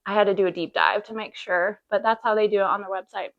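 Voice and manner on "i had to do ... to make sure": ordinary talk, nothing marked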